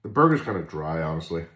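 talking around a toothpick; toothpick in mouth. Real, not acted. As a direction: lips holding small stick. Seen 0: no chewing seen; toothpick at lips while talking, bite comes later.

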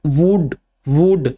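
वुड, वुड stretched slow, lips rounded on the long oo. Shown as a demonstration. Teacher models rounded lips for students, slow long oo. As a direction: round your lips and draw out the oo in वुड, slowly. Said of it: "lips जो हैं वो circle हो जाते हैं".

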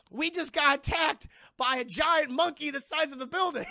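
loud, high, disbelieving yell; exasperated shout. Retold or imitated. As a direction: shout at him for eating now. Soldier scolding Cole for eating.

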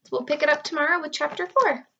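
ordinary delivery

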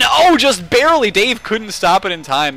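high, loud excited call; excited commentary. Real, not acted. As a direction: shout the near miss. Close round win.

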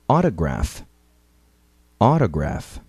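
Autograph with a light tapped T; flapped T. Shown as a demonstration. Teacher models Autograph twice, flapped T. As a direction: say Autograph as awdograph. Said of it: "Ouça e repita".

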